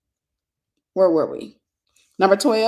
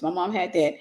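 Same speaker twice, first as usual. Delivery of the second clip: ordinary delivery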